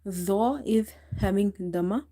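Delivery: ordinary talk, nothing marked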